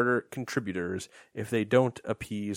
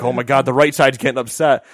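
mock alarm; 2 semitones higher, 10 dB louder than usual. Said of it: "And you're like".

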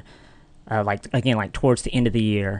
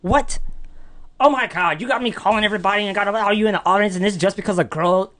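higher-pitched incredulous rant; disgusted; 9 semitones higher, faster than usual. Retold or imitated. Brother scolding sibling for drama over a girl, mock disgust. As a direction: imitate a fed-up brother, exasperated and pitched up. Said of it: "equally disgusted with me".

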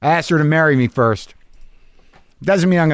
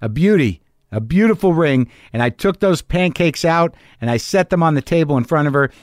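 ordinary delivery

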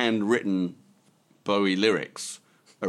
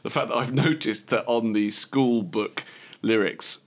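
usual speaking voice

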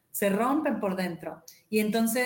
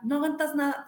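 mock reproach; 5 semitones higher than usual. Imitating a reproach.

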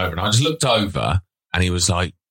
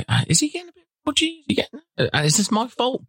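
much higher, anxious fretting voice; high worried voice. Retold or imitated. Inner worried thoughts, voiced.

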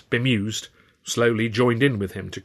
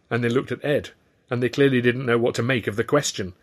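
ordinary delivery

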